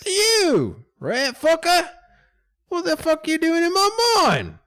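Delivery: loud, very high outraged yell; furious high-pitched holler; Don John. Acted. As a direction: yell at trespassers, voice pushed way up. Mine boss yells at rats.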